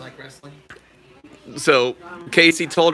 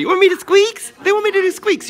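much higher, louder squeak talk; squeaky voice; 3 dB louder, faster than usual. Pretend. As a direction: squeak it out for the baby on request. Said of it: "Somebody wants me to squeak for you".

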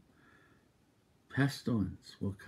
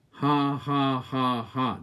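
loud, higher ha ha; defiant laughter; 4 semitones higher, 6 dB louder, much slower than usual. Retold or imitated. Preacher laughing off despair for the congregation. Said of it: "he started doing this".

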